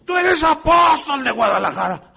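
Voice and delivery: high, loud proclaiming voice; hammed-up prophetic shout; mock prophetic proclamation. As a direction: announce it like a pompous prophet. False prophet proclaiming someone apostle.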